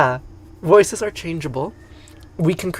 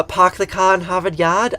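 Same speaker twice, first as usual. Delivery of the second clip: Boston accent; 5 semitones higher, 4 dB louder than usual. Shown as a demonstration. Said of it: "What is a hard R as opposed to your R?".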